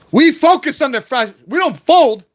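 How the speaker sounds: ordinary talk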